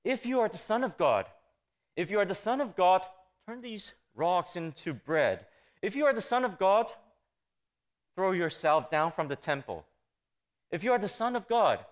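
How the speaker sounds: high, pressing challenge from the tempter; taunting Satan. Acted. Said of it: "it was precisely Satan's rhetoric".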